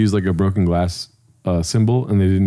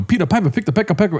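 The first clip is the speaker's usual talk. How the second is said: quick, high mock tongue-twister; squad goon; 6 semitones higher, faster than usual. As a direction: rattle it off like an eager goon. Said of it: "one of the goons in his little squad was like".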